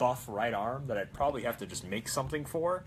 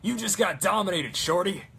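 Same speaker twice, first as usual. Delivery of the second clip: higher, louder gloating taunt; engineer taunt; 6 semitones higher, 6 dB louder than usual. Retold or imitated. Cosplayer quoting the engineer dominating, gloating. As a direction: crow it like a game taunt.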